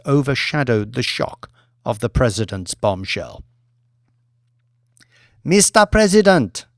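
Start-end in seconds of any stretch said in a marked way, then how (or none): 5.4-6.7 raised, loud and ceremonial, slowing into the toast; a showwoman working a hall of presidents; Mamadou's grand address. Acted.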